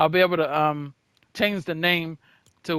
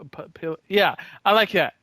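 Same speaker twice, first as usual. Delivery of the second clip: ordinary talk, nothing marked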